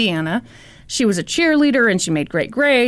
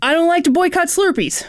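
higher-pitched aggrieved complaint; mock aggrieved; 6 semitones higher than usual. Reading out his vlog rant, aggrieved. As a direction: act out a petulant grievance.

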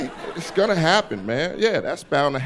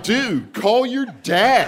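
slow, high-pitched, utterly self-assured; 8 semitones higher, much slower than usual. Retold or imitated. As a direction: deliver it like the obvious answer to everything.

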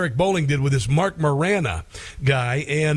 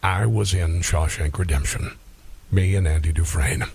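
much lower, deep actor impression; Morgan Freeman; 10 semitones lower than usual. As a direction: drop right down into a famous deep-voiced actor, gently correcting. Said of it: "Morgan Freeman would be very upset. He'd be like".

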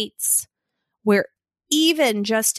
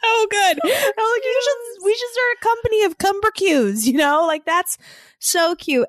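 much higher, gushing with delight; 10 semitones higher than usual. Real, not acted. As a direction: squeal over how cute it is. Delighted about son's word.

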